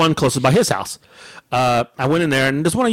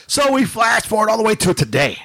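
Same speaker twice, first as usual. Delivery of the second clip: dramatic transition; 7 semitones higher than usual. Real, not acted. Dramatic time-jump transition in storytelling.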